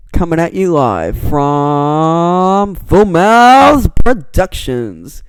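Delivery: loud, drawn-out show announcer; hammed-up announcer. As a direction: stretch it out big and rising, like a mock announcer. Mock announcer sign-off for his channel.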